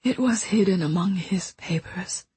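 soft, fading whisper; quiet and distant. Acted. Dying woman confesses a secret to the boy, quiet distant whisper. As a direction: speak from far away, almost gone. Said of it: "her voice quiet and distant".

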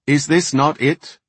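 loud, high impatient query. A narrator's reading. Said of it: "queried Varden impatiently".

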